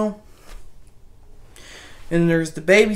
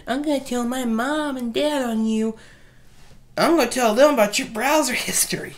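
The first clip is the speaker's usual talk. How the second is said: put-on higher, bratty little-kid threat; 6 semitones higher than usual. Kid threatens his babysitter, higher bratty voice. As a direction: tattle like a smug little kid. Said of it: "kid threatening babysitter".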